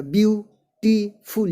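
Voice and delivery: ब्यूटीफुल split as ब्यू, टी, फुल; ब्यूटीफुल with a pause between its three syllables. Shown as a demonstration. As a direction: break ब्यूटीफुल into three separate beats. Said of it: "ये तीन syllable का हो गया".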